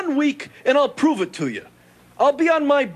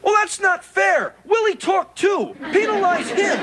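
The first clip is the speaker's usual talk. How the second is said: high-pitched complaint of foul play; indignant protest; 6 semitones higher than usual. Acted. Protests the countdown.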